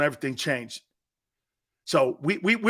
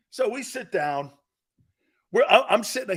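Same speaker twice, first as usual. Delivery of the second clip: normal talking voice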